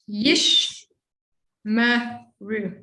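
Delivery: "Yish, ma, ru" broken apart, each syllable separately; split into syllables. Shown as a demonstration. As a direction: pause between "Yish" "ma" "ru". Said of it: "really we're just talking about a syllable".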